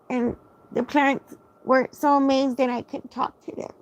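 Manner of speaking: high, put-on little baby voice. Shown as a demonstration. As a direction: talk like a tiny baby, sweet and high. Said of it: "'cause I've always had a baby voice ... but I'll use it".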